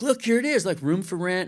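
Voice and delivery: retold burst of eagerness; excited discovery. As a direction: point it out with sudden excitement. Retold eager find of a room.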